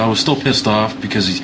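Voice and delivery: ordinary talk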